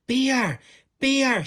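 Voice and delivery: high, very loud scream; girl faking an alarm, yelling to the town. A narrator's reading. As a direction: cry it as loud as possible. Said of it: "she cried as loudly as she could".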